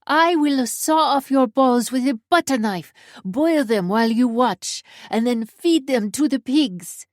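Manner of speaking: put-on loud, high Russian-accented furious threat; Russian woman crying out. A narrator's reading. Russian woman hollering threats at Scorpion, Russian accent. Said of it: "a distinctly Russian voice cries out".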